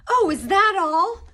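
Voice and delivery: loud, high indignant retort; angry. A narrator's reading. Harry challenging the elf, angry. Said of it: "said Harry angrily".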